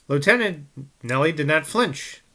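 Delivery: usual speaking voice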